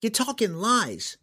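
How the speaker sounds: put-on high, slow and scornful young girl; girl spitting words. A narrator's reading. Captive girl spits defiance at her captor, high and slow. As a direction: spit each word at him, a girl refusing to give in.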